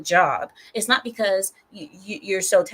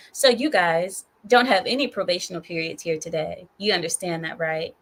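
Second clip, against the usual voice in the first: normal talking voice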